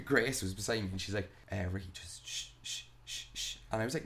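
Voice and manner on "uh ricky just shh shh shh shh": soft, half-voiced shh to calm him down; retold shushing, much softer; hushed shushing. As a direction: hiss him quiet like a worried friend at the bar. Grace hushing him at bar.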